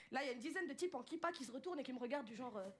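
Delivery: normal talking voice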